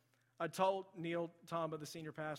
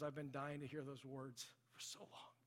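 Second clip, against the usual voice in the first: retold tearful relief; 2 semitones lower, 8 dB quieter, slower than usual. Friend finally hears the apology he longed for, retold tearfully. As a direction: let it sink softly, as if near tears. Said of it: "he just burst into tears".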